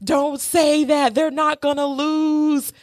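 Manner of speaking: hammed-up angry date yelling; retold scream. Date yelling at her over the game, a mild mock scream. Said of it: "He screams at me".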